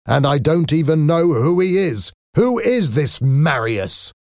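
angry. A narrator's reading. Father raging at daughter over her lover, angry. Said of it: "why are you so angry?".

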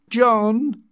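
high, loud call through a door. A narrator's reading. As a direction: call to someone in another room. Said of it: "called from the bathroom".

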